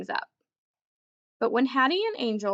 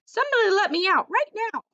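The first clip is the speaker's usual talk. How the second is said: high, loud and fast; frantic plea; 10 semitones higher, 5 dB louder, faster than usual. Acted. Dog scratching the screen demanding to be let out, frantic. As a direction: demand it urgently.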